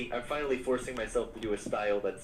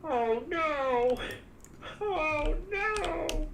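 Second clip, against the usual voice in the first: squeaky high oh no, rising higher; high-pitched dismay; 4 dB louder, much slower than usual. Real, not acted. Told she sounds like a Muppet, squeals in high dismay. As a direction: yelp it like a startled Muppet. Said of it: "You sound like a Muppet on the stream".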